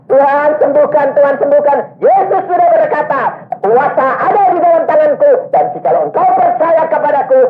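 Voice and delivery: fiery exhortation. Retold or imitated. Preacher urging the young man to believe, in a high ringing voice. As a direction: preach it at him like a command. Preacher quoting prayer and scripture.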